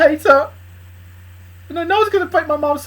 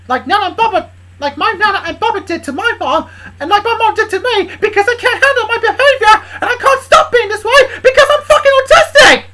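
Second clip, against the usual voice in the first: shouting high and loud, half laughing; furious rant; 7 semitones higher, 6 dB louder than usual. Acted. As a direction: yell it out in a furious outburst. Character's tearful furious rant.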